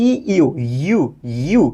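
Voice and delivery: ই ইউ sounded out alone, with pauses between repeats; isolated opening sound. Shown as a demonstration. Teacher shows learners what European starts with, ইউ sounded out separately. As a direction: isolate ইউ as a "yoo" glide, one at a time. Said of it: "আপনি শুরু করেছেন কি দিয়ে".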